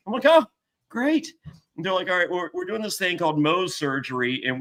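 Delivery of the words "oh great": high, dry sarcastic great; mock cheerful sarcasm. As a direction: say it like the worst news ever. Sarcastic reaction to cancer news.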